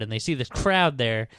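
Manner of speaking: ordinary talk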